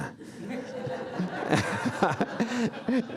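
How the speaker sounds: high, bubbling laugh; a real, delighted high laugh. Real, not acted. Delighted laugh after the cheer.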